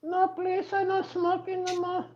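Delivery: very high, soft falsetto maid voice; high falsetto maid, played gently; mock housekeeper voice. Comic voicing the maid's polite refusal in soft falsetto. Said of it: "I'll be like this".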